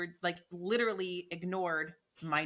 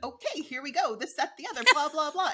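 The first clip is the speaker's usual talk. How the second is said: high, loud, peppy mock chatter; imitated cheerleader; 6 semitones higher, 7 dB louder than usual. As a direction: breeze in like a chirpy cheerleader. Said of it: "you just came in your little cheerleader stuff".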